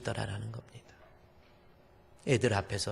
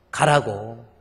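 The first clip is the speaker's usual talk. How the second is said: angry father-in-law; 8 dB louder than usual. Retold or imitated. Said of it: "막 화를 내면서".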